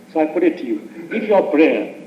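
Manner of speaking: usual speaking voice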